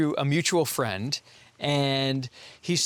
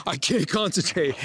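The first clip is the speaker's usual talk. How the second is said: laughing; 6 semitones higher than usual. Real, not acted. Cracking up mid-answer.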